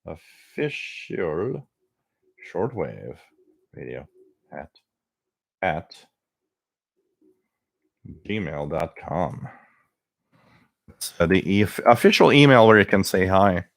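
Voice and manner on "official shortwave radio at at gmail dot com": slow, dictated. Shown as a demonstration. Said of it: "Let's, uh, put it here".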